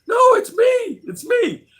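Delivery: high loud falsetto no, it's me; imitated insistent woman. A stranger insisting she's the one he's meeting, high loud falsetto.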